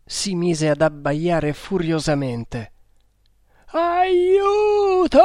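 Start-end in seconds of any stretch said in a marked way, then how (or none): 3.7-5.2 high desperate shout from far off; distant cry for help. A narrator's reading. Drowning man cries from the river, desperate distant shout. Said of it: "ripeté la stessa voce".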